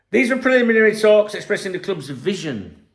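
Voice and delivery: hammed-up press-statement read; mock official statement. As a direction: parody a club press release. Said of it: "Here's your disclaimer again".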